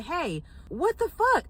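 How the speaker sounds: slow, high, drawn-out indignant question; retelling the call to her friend, slow and disbelieving; mock outrage. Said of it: "called her and said".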